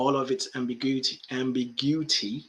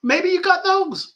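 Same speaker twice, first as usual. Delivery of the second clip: high squeaky voice; 9 dB louder than usual. Acted. Answering the worry in high voice.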